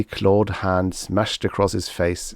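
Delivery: plain reading voice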